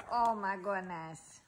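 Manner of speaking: usual speaking voice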